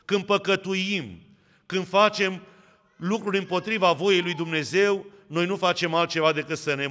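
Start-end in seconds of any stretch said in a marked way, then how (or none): none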